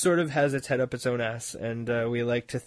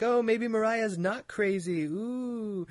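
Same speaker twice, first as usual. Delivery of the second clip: mock hopeful; 10 semitones higher, slower than usual. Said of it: "everybody's like".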